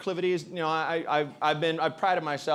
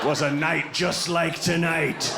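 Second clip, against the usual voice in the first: put-on old drunk's tale. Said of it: "he probably tells everyone he meets in that bar".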